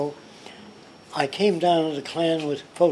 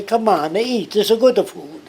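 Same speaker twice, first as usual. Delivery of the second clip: louder, higher mock Italian accent; 6 semitones higher, 6 dB louder than usual. Showing how an Italian upbringing shapes speech, Italian accent. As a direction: put on a hearty Italian accent. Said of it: "if you're brought up in Italy".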